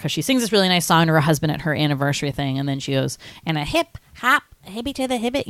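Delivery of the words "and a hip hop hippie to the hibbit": put-on higher, soft old-lady rapping; imitated granny doing a rap; little old lady. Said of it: "a little old lady".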